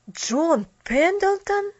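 slow, high, incredulous question; drawn-out, shocked repeat of a name; astonished. A narrator's reading. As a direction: echo the name in stunned disbelief. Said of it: "отшатнулся поражённый Джимми".

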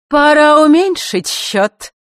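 high, slow, loud taunt; taunting threat. A narrator's reading. Elena threatens the newly arrived Queen, tauntingly. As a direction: draw it out high and menacingly.

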